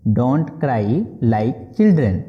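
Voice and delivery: slow, careful repeat of the phrase. Shown as a demonstration. Teacher repeating the English phrase slowly for students. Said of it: "बोलेंगे".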